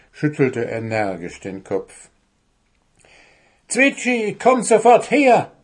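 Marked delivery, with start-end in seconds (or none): 3.7-5.5 high, loud angry summons; irate gnome's yelled command; demanding. A narrator's reading. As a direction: order the bird over at once, shouting. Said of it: "forderte der Wichtel".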